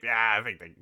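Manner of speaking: ordinary talk, nothing marked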